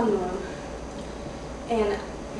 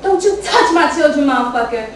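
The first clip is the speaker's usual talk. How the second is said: furious shout; 3 semitones higher, 7 dB louder than usual. Acted. Mother defending her children.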